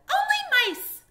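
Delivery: tiny squeaky high mouse voice; little mouse. A narrator's reading. Mouse guarding its home from a stranger, high squeak. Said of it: "they said".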